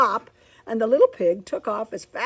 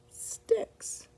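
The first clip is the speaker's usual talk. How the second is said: whisper; 6 dB quieter than usual. A narrator's reading. Story builds to whisper.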